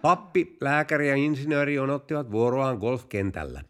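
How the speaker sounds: ordinary talk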